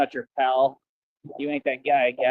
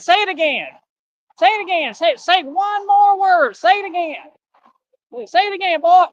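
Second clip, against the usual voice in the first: high, worked-up squawking threat; hammed-up high-pitched challenge; Pinky; 5 semitones higher, much faster than usual. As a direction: dare them with rapid repeats. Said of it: "I'm like Pinky".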